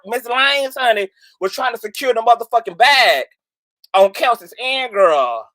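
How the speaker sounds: ordinary talk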